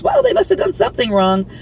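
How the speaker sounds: lightly voiced sneering bystander; mock bystander verdict. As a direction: mimic their smug judgment, high and sneering. Mocking what onlookers would think.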